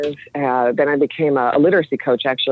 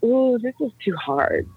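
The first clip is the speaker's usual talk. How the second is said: higher, slow, softer mock whine; mock reluctant leaders; 6 semitones higher, 4 dB quieter than usual. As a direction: imitate leaders wimping out. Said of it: "our leaders were like".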